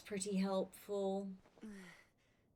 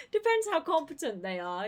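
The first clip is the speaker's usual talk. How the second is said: laughing; 9 semitones higher, 8 dB louder than usual. Real, not acted. Laughs at murder question.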